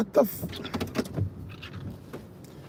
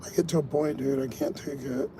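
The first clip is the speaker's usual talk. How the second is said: defeated, fading; 2 semitones lower, 9 dB quieter than usual. Real, not acted. Frustrated driver to his viewers, worn out and fading. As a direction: give up, trailing away quietly. Driver overwhelmed by app alerts.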